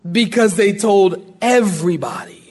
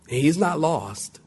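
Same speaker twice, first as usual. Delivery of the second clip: ordinary talk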